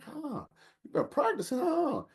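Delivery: high, teasing put-on voice; mock surprised taunt. Father teasing his son mid-game, high mock taunt. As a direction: rib him like he got lucky.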